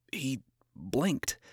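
usual speaking voice

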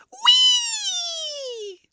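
very high, long squeal; gleeful whee. Acted. Tiny housekeeper punted across the air, gleeful squeal. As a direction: squeal it like flying through the air.

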